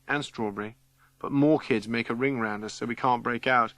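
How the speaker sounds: plain reading voice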